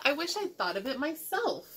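high, animated admiration; excited shrieking. A narrator's reading. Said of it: "shrieked Nebraska".

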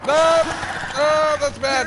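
high, loud alarmed outburst; alarmed cry. Real, not acted. Player reacting to danger in a game, alarmed cry.

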